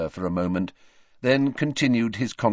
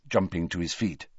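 ordinary delivery

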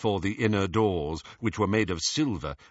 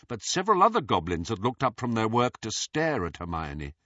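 ordinary delivery, nothing marked

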